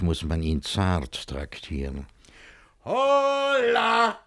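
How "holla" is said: loud high call at the door. Acted. Master at his own door, calling out loud. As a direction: holler to be let in.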